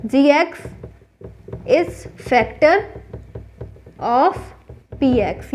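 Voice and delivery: very slow, word-by-word answer; slow dictation. Shown as a demonstration. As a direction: dictate each word with long pauses. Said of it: "तो बोलेंगे". (रुक-रुक कर लिखवाना)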